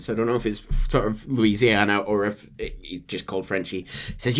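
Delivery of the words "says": usual speaking voice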